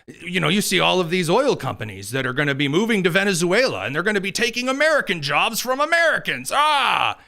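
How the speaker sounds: hammed-up panic, climbing to a cry; mock alarm. Pundit mimicking her case against oil companies, mock alarm. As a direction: parody a worrier working up to a shout.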